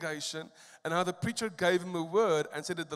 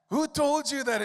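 higher, louder repeat of the cry; retold tearful outcry; 8 semitones higher, 6 dB louder than usual. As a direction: echo his shock, pushing harder. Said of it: "he jumped up crying".

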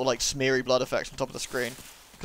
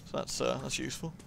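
normal talking voice

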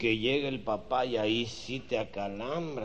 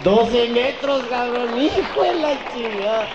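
high, loud, very slow shout; punchline shout; 13 semitones higher, 13 dB louder, slower than usual. Acted. Teller shouting the punchline to his friend, loud and slow. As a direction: stretch out every word at full volume.

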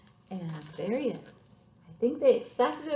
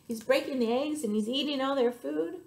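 plain reading voice

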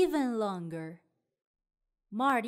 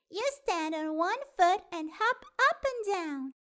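higher, lightly voiced girl; chirpy young girl giving advice; Kelly voice; 7 semitones higher than usual. A narrator's reading. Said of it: "says Kelly".

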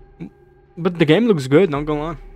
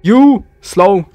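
sharp loud yell at the enemy; shouting; 4 semitones higher, 8 dB louder than usual. Real, not acted. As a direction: bark the command, higher and louder. Yelling at approaching animatronic.

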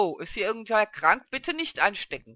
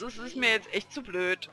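ordinary talk

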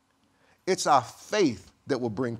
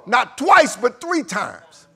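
anguished prayer building to a shout; 6 semitones higher, 11 dB louder than usual. Acted. Peter crying out to the Lord over his denial, building to anguished shout. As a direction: confess louder and louder until you are crying out.

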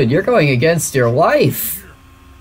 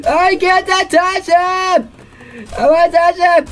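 excited squeal; 12 semitones higher than usual. Real, not acted. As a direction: squeal with eager glee. Overexcited urge to touch game figure.

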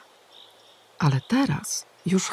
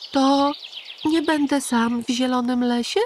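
put-on high childlike wondering question; a small boy amazed; curious little hedgehog; 9 semitones higher than usual. A narrator's reading. Little Igiełka asking the pigeon, high child voice. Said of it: "Dopytywał Igiełka".

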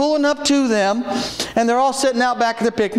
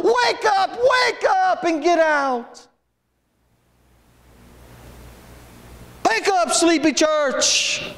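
rousing call; 6 semitones higher, slower than usual. Real, not acted. Preacher rousing sleepy church.

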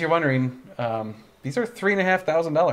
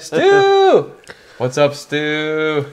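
excited; 3 semitones higher, 6 dB louder, much slower than usual. Real, not acted. Greeting a friend who joined.

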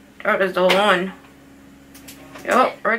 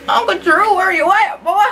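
put-on old man voice; 8 semitones higher than usual. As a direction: call out like a feisty old man. Voices Uncle Drew.

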